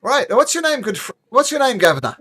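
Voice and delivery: high, loud cheerful host voice; jovial announcer. Acted. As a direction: call out to the contestant. Announcer addressing contestant.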